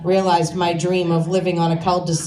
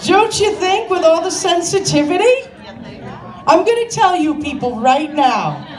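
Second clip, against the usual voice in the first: ranting appeal; 7 semitones higher than usual. Real, not acted. Ranting at audience.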